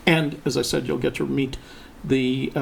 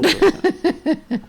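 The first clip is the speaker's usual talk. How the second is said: high, slow sing-song tease; 5 dB louder than usual. Pretend. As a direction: stretch it out high and teasing. Teasing echo of partner.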